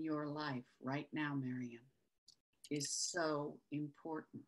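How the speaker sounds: hushed, slow psychic reading voice; slow soft channeling. Acted. Psychic reading to Miriam, slow and hushed. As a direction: speak slowly and softly, as if channeling spirit.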